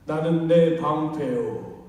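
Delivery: God declaring slowly and loudly; solemn voice of God. Acted. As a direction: proclaim it weightily from above. Pastor voices God's words.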